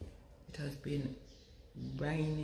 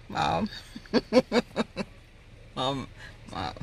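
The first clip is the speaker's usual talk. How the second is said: ordinary delivery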